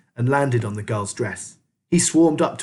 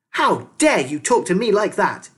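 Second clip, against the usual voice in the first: put-on high, louder, outraged girl; indignant shout; 8 semitones higher, 5 dB louder than usual. A narrator's reading. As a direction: shout back, offended. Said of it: "Militia shouted".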